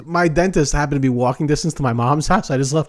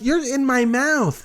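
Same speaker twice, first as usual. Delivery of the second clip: ordinary delivery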